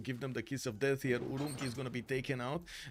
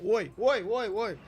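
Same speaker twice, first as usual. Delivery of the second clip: high, loud "oi, oi" called out; mock the girls calling out oi; imitating northern girls' "oi"; 8 semitones higher, 8 dB louder than usual. Imitating girls from the north of Romania calling oi, high and loud. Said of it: "the girls in the north of Romania do like to say".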